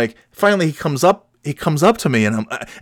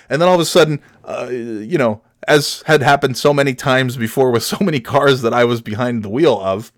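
ordinary talk

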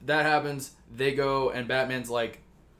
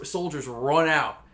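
usual speaking voice

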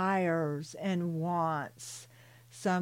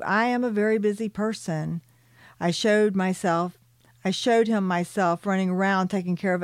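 louder, firm reading-aloud voice; story narration; 6 dB louder, faster than usual. Acted. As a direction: read the story out with energy. Reads her book aloud.

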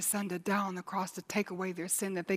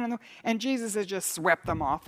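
ordinary delivery

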